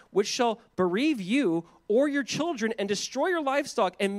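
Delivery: measured, emphatic proclamation of God's warning; scripture reading. Acted. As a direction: read it out deliberately, leaning on key words. Reads scripture aloud.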